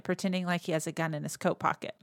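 ordinary talk, nothing marked

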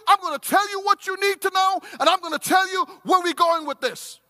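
loud, high, driving rebuke from the pulpit; preaching at full force. Acted. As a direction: hammer the warning home to the congregation.

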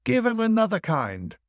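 high, ringing order; calling out. Acted. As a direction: fling the order at the servants. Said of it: "The host cried out".

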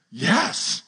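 drawn-out, wide-eyed yes; mock-astonished customer; amazed caller. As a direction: gasp a credulous yes. Skit of psychic's credulous caller.

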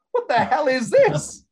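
high, loud and slow disbelief; real exasperated shout; incredulous outburst. Real, not acted. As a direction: explode at the absurd line. Outraged at the script's ending.

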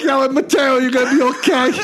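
high-pitched retold call to a friend; imitating friends hollering after him. Friends calling out to departing patient.

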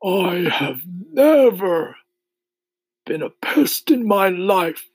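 put-on old grasshopper's slow, withering rebuke; slow scornful voice. A narrator's reading. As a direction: declare it slowly with haughty scorn. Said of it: "speaking in a deep, slow, scornful voice".

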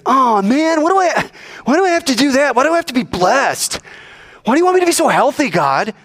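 high-pitched exaggerated complaint; mock whining. Preacher mimicking an ungrateful believer moaning to God, mock whining. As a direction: whine it, high and put-upon.

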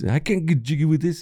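high, lightly put-on African accent; imitating Michael Blackson. Retelling a movie scene, mock Blackson voice. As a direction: quote him with a hint of his accent. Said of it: "Michael Blackson comes into the store".

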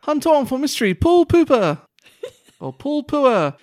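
high, loud headline voice; headline announcer. Acted.

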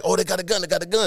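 high alarmed woman's cry; panicked lady. Retold or imitated. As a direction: warn of the gun in a panic. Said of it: "the lady like".

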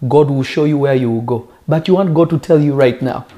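normal talking voice

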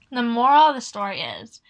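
ordinary delivery, nothing marked

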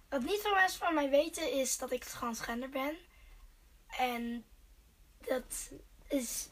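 usual speaking voice

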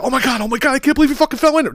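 high, fast mock panic. Owner who should have panicked, fast high mock panic. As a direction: ham up frantic owner panic. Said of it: "But there was no, like".

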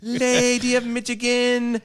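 slow, high proclamation; grand herald voice. Acted. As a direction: announce her titles like a herald. Grand title mockery.

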